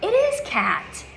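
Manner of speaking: high and slow, delighted; excited praise. Real, not acted. Teacher cheers the child's right answer, high and slow. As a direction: stretch it out with real delight.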